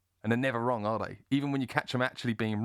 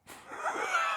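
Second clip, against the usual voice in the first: laugh. Real, not acted. Breaks off laughing at himself.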